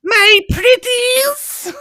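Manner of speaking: high, slow cackling witch. Acted. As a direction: stretch it out like a wicked witch. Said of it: "you sounded like a witch".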